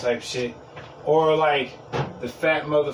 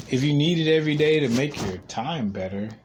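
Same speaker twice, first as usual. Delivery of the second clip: ordinary talk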